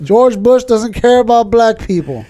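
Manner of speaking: hamming up the famous broadcast line, slow and punchy; mock famous TV quote. Recalling the Katrina moment to his cohost, laughing mock quote. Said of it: "You gotta play it".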